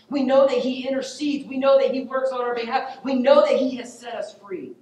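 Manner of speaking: high, loud, driving sermon delivery; impassioned preaching. Real, not acted. Preacher urging the congregation to trust the risen Jesus, impassioned.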